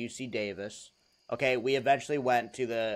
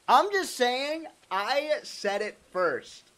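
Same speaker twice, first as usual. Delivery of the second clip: defensive whine; 10 semitones higher, slower than usual. Real, not acted. Defensive high-pitched protest.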